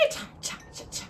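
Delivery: soft unvoiced cha cha cha; whispered sign-off. Real, not acted. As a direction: breathe it out as a playful goodbye. Playful sign-off.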